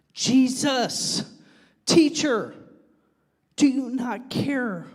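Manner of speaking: high, drawn-out plea; desperate plea. Acted. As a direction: beg the teacher to notice. Acts out disciples pleading with Jesus.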